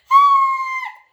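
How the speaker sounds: piercing high scream of fright; shriek. A narrator's reading. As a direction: shriek in sudden terror. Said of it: "she shrieked".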